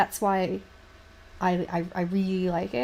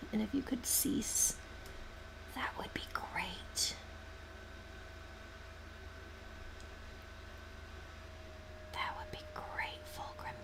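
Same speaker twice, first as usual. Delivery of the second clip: softer and softer mock-courteous whisper; mock polite whisper; 13 dB quieter than usual. Speaking to the Fulgrim miniature, mock polite whisper. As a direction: hush it like a polite office request.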